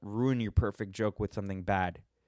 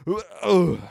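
loud, high ugh of disgust; disgusted groan; 8 semitones higher, 7 dB louder than usual. Real, not acted. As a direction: let out a gross-out groan. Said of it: "I don't know why I made that sound".

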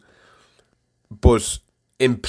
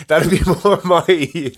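laughing; 8 semitones higher, 4 dB louder, much slower than usual. Real, not acted.

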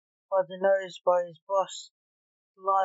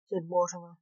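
plain reading voice

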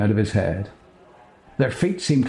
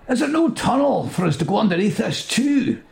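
much higher, whiny companion voice; high character voice; 12 semitones higher than usual. Acted. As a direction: pitch it up high like a fretful sidekick.

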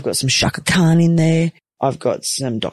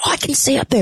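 high-pitched alarm, retold; 11 semitones higher, faster than usual. Patient telling theatre staff she can see, alarmed. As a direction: pipe up high in mock alarm.

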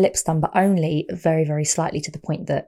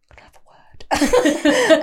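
slow, high stage whisper; mock urgent whisper; 11 semitones higher, 5 dB louder, much slower than usual. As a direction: whisper it like pulling a partner aside. Retold aside to her partner.

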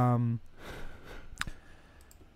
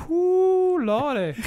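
startled whoop; 14 semitones higher, 5 dB louder, much slower than usual. Real, not acted. Reacting to a panel.

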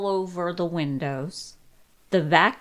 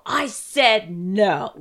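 slow, lightly voiced demon; mild demonic growl, slowed; 5 dB louder, much slower than usual. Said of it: "in a demonic voice go".

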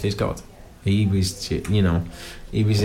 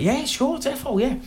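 much higher, over-eager put-on posh voice; nervous fake posh; 14 semitones higher than usual. An actor invited to meet Souness, answering in fake posh. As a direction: play it as a flustered fan faking class. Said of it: "I tried to pretend I was posh".

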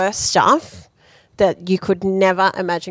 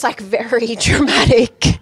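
ordinary delivery

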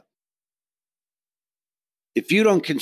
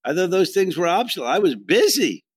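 mock defensive; 7 semitones higher, 3 dB louder than usual. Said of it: "I said".

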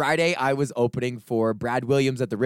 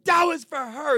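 much higher, whiny mock boyfriend voice; high whiny protest. Skinny guy complaining to the guard, high whiny voice. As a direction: whine it up high, indignant.

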